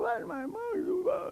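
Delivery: high, slow mock-whining "Well, my mom"; whiny client. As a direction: imitate a client starting to whine about the past. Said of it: "They always wanna come in and whine about their lives".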